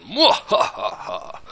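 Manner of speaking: slow evil mwahaha laugh; villainous cackle, drawn out; mad scientist. Acted. Evil laugh after secret design.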